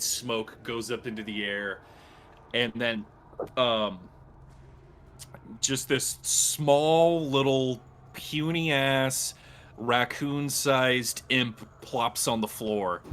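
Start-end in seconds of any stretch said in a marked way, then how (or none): none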